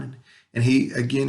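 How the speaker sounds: normal talking voice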